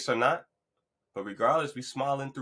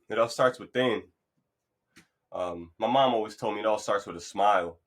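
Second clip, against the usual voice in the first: ordinary talk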